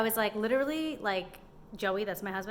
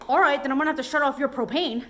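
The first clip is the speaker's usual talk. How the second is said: louder, higher imitated threat; mock threat; 6 semitones higher, 9 dB louder than usual. Retelling the man threatening to cut her propane, loud mock threat. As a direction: play back his threat, loud.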